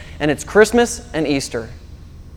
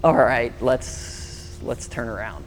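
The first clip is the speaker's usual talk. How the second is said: much softer, lower, very slow, defeated; husband apologizing to his wife, sheepish and soft; sheepish apology; 6 semitones lower, 10 dB quieter, much slower than usual. Retold or imitated. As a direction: give in quietly. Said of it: "apologize to my wife".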